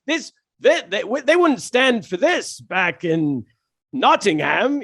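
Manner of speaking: slow, lightly put-on British accent; a touch of a smug Nottingham comic. British comic telling crowd about Nottingham, in a light British accent. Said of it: "shout out to your British accent".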